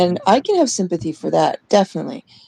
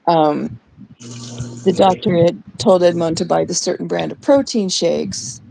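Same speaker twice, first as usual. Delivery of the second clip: usual speaking voice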